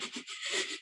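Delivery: imitated hitching inhale; stuttering sob breath. Imitating the student trying to breathe along, hitched sniffles. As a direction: mimic a bawling child trying to breathe in. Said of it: "like that, 'cause he's still bawling his snot".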